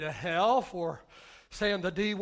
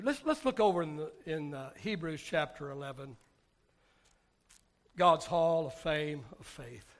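ordinary talk

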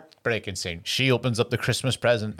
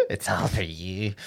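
slow, low and soft, imitating a whisper; mock creepy murmur; 5 semitones lower, 6 dB quieter, slower than usual. Co-host echoes the Damien comeback, slow mock-creepy murmur. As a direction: echo the line as a hushed, sinister joke.

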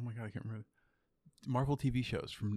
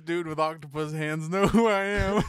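mock bewildered; 4 semitones higher, 8 dB louder than usual.

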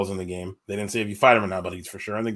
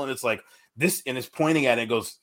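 ordinary talk, nothing marked

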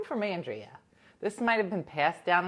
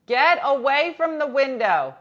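much louder and higher, a firm command; calling out sharply; 8 semitones higher, 12 dB louder than usual. Real, not acted. Owner yelling at the dog to leave the window. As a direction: shoo the pet off the window.

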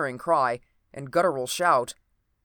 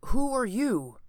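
higher, sharp questioning voice; startled demand; 9 semitones higher, slower than usual. A narrator's reading. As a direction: demand it, pistol raised. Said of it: "the voice replied".

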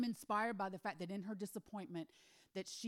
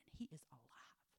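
whisper; 7 semitones lower, 16 dB quieter than usual. Acted. Gehazi tells the miracle.

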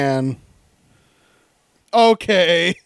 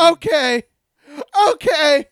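dreading whimper; 9 semitones higher, 3 dB louder than usual. Real, not acted. Dreading gruesome dare.

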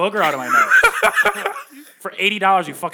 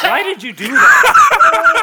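laughing; 14 semitones higher, 10 dB louder, much slower than usual. Real, not acted. Booger just licked out.

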